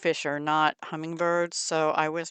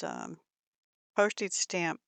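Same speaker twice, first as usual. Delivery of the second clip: ordinary delivery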